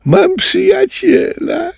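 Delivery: very high, slow, joyful squeal; overjoyed tapir cry. A narrator's reading. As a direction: shout it out like a delighted little tapir. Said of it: "krzyknął uradowany tapirek Ciro". (uradowany, bardzo wysoki okrzyk tapirka)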